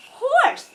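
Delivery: high, loud mock whinny; playful horse whinny; horse neigh.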